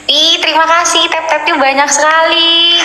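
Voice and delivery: bright, high burst of thanks; excited thanks. Real, not acted. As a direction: gush over the flood of taps. Thanking viewers for many taps. (terima kasih dengan girang dan suara tinggi)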